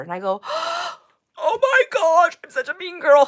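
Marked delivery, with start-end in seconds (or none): 0.4-0.9 high startled gasp of realization; a hammed-up shocked intake of breath; sharp mock gasp. Sudden realization of own meanness. 1.4-3.3 a comic gasping self-reproach; mock dismay. Realizes she was mean.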